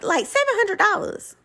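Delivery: high put-on voice of the mother-in-law; lightly acted mother-in-law. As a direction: voice her matter-of-factly.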